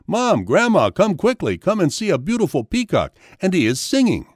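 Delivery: high, urgent storybook call; excited screaming. A narrator's reading.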